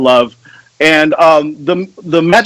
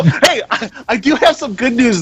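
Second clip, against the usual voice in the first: chuckling through the words; a light laugh as you speak; 7 semitones higher, 4 dB quieter than usual. Real, not acted. Joking, laughs while announcing.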